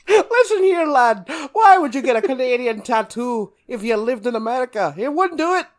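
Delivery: loud, high stage-Irish brogue; Irish accent, over the top and laughing; hammed-up Irish accent. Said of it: "that's Irish".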